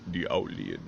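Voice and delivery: low, quiet owl voice. Acted. Owl character naming himself, low and quiet.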